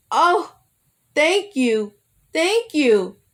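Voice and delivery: loud, high, overjoyed shouts of thanks; hollered thanks. A narrator's reading. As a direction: holler it so the whole plantation hears. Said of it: "he hollered loud enough for everyone".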